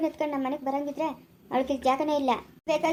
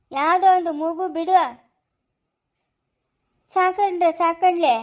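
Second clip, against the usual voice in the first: louder, slowing on the last words; raised voice; 6 dB louder, slower than usual. Acted. Elder insisting to family, loud and slow. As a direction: insist firmly over the others. Family quarrel over adopting baby.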